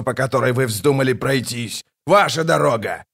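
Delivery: furious tirade. Acted. Kallor blames the gods for the ashes, furious shouting. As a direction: hurl the blame at them.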